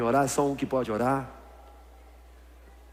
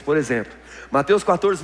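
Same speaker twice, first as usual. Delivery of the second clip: usual speaking voice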